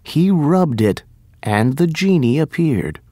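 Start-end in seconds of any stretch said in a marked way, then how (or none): none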